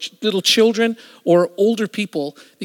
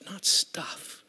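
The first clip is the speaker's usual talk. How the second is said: soft breathy whisper of the final words; 9 dB quieter than usual. Real, not acted. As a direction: drop to a whisper to drive it home. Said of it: "sermon punchline".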